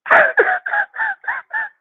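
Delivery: high shrieking burst of laughter. Real, not acted. Laughing at her mistake.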